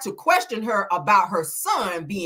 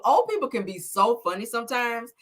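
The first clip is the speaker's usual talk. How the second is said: ordinary talk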